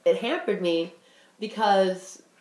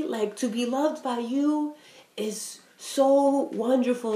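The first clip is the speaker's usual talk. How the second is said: higher, slow, tender voicing of mom; imitating mom, tender; 4 semitones higher than usual. Mom telling daughter how loving her feels, slow and tender. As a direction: lightly voice mom's fond words, slowly. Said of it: "she's like, she's like".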